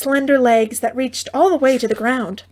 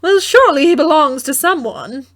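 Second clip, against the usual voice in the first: mother voice, doubtful; 5 semitones higher, 7 dB louder than usual. A narrator's reading.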